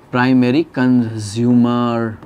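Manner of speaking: drawn-out, syllable by syllable; measured, very slow term read-out; slow dictation. Shown as a demonstration. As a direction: stretch both words out for note-takers. Teacher dictating the new term.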